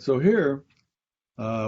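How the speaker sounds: ordinary delivery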